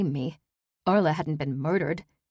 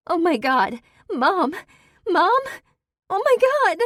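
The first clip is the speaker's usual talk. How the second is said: shrill, much higher, louder panic rising; panicked screaming; 14 semitones higher, 7 dB louder than usual. Acted. Daughter discovers her dead mother, rising panicked screams. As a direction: scream in horror, climbing higher each time.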